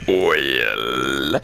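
deep, drawn-out British "Oil"; mock British pronunciation of "Oil". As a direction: say "Oil" low and rounded like a posh Brit. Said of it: "How British people say oil".

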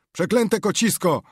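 loud, high-pitched angry yell; angry shout. A narrator's reading. Neighbour cursing a yowling cat at night, angry shout. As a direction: curse it out loudly in anger. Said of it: "Gniewny okrzyk".

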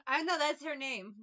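real laugh at yourself. Real, not acted. Laughing at getting the name right by accident.

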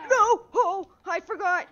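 loud, high wail of dismay; dismayed cry. Real, not acted. Getting hit by the forgotten attack, a loud high wail. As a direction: cry out at the mistake.

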